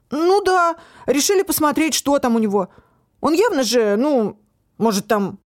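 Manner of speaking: put-on high, defensive teenage boy; young lad making excuses, voiced high.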